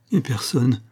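ordinary delivery, nothing marked

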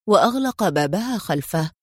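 usual speaking voice

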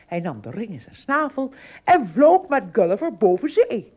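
usual speaking voice

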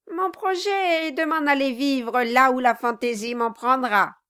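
loud, high mocking threats; loud gloating at his wife; loud gloating taunt. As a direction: gloat over her.

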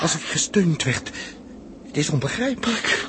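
amazement. Acted. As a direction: marvel out loud at the climb. Watching climb up rope ladder. (uitroep van verbazing)

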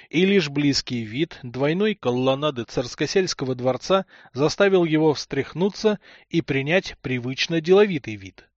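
ordinary delivery

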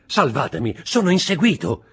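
hunted, high-pitched plea; urgent pleading. A narrator's reading. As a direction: voice rising high in panic. Hunted man pleads with the women.

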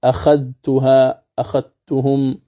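أخذتها أخذتهم slowly, clear dhal; recitation demo. Shown as a demonstration. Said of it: "نحو".

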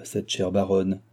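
ordinary delivery

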